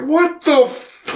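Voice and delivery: high, slow, stunned mock gasp; mock shock. Soldiers seeing blood pour from the shoe, high slow mock shock. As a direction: drag it out in horror.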